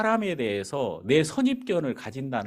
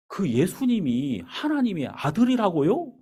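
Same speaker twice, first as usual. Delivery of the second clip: 하나님의 rising in disbelief, eyes wide; astonished question; 7 semitones higher than usual. Retold or imitated. 놀라서 되묻는 말투. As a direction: driver asks the pastor, stunned and disbelieving. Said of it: "눈 땡그레지면서".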